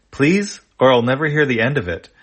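high, loud begging, then fast; desperate plea from a tired friend; pleading. Acted. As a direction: beg him, then rush the rest. Wezzel begs Chone for help.

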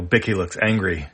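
plain reading voice

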